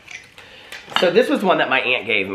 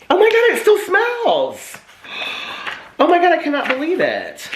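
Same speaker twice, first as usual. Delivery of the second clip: delighted disbelief. Real, not acted. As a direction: gasp it out, thrilled the smell lasted. Old scratch book still smells.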